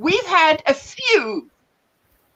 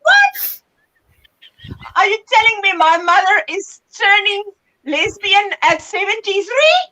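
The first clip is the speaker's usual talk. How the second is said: shocked disbelief; 4 semitones higher than usual. Retold or imitated. Telling Jessica in the car, high shocked disbelief. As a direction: retell your own shock at the back of the car. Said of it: "So I'm like".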